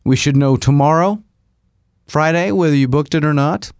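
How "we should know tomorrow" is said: ordinary delivery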